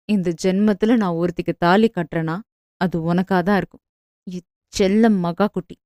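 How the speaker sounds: low, soft, tender vow; man tenderly vowing to marry his sweetheart; tender promise. A narrator's reading. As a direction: promise it gently, lovingly. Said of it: "செல்லமாய்".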